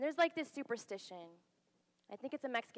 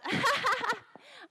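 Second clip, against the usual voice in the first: a high, loud burst of awkward laughter; nervous laugh; 9 dB louder than usual. Retold or imitated. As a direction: laugh it off in shock. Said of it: "So I just laughed".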